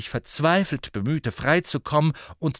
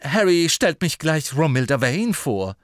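eager Ron; 6 semitones higher, 7 dB louder than usual. A narrator's reading.

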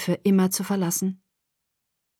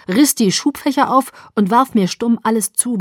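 ordinary delivery

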